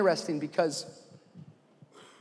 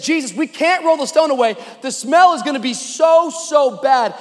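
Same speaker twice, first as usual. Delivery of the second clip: higher, loud, dismayed objection; pleading protest; 8 semitones higher, 8 dB louder than usual. Acted. Martha warning Jesus about the smell, pleading protest. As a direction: insist it cannot be done.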